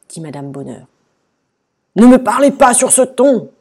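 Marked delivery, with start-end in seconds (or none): 2.0-3.5 high, loud moaning protest; indignant wail. A narrator's reading. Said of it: "gémit le roi". (gémissement offusqué)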